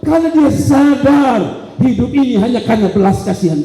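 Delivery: high, driving sermon delivery; forceful preaching. Acted. Preacher urging the congregation to realise why he seeks God, forceful.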